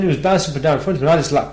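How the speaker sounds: usual speaking voice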